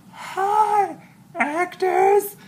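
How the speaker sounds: high, loud fangirl squeal of hello; mock-squealed hello, drawn out; starstruck greeting. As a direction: gush it at the stage in a high voice. Playful greeting to performers onstage.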